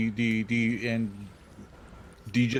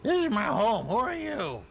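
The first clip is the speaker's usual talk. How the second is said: through a door; 11 semitones higher, 3 dB louder than usual. Acted. Lion knocker questions the visitor.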